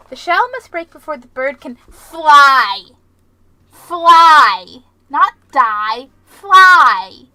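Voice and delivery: booming, slow proverb, then a shouted Fly; slow, loud mock-epic speech; grand declamation. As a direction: proclaim it like an epic prophet.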